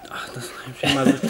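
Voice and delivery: fast whisper to himself; rushed whisper; whispered aside. Real, not acted. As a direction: mutter it unvoiced, quickly. Quick aside under breath.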